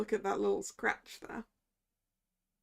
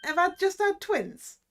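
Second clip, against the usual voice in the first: cheeky Cockney sales pitch; Cockney hawker; 5 semitones higher, 4 dB louder than usual. Acted. Gossip pitches his tidbits to the lawyer, cheeky Cockney. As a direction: wheedle like a London street seller. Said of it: "a good voice for him".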